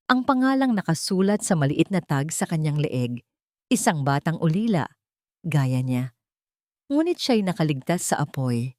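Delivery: usual speaking voice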